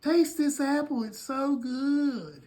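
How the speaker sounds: high coaxing wife voice, slow at the end; Eve's voice. Acted. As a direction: Eve coaxing Adam to taste the apple. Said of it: "walked over to Adam and said".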